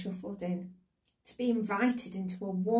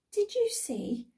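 put-on high, drawn-out child's question; little girl; 12 semitones higher, 4 dB louder, slower than usual. A narrator's reading. Girl turns to her sister indoors, little girl voice. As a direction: ask it slowly, pitched right up like a girl. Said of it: "said Janet to Nora".

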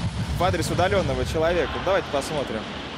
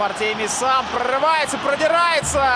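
rising, louder play-by-play; excited; 9 semitones higher, 4 dB louder than usual. Real, not acted. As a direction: build up to the scoring chance. Attack building toward goal.

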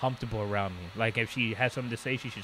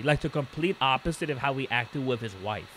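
ordinary talk

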